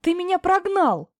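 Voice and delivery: high, stressed on прогнал. Acted. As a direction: raise the tone, accusing. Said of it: "повысила тон Плюшка".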